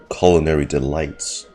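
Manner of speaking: usual speaking voice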